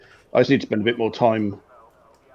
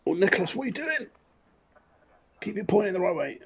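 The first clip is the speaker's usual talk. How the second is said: high, fast exasperation; self-scolding; 9 semitones higher, 6 dB quieter, faster than usual. Real, not acted. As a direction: tell yourself off in a rush. Scolding himself mid-race.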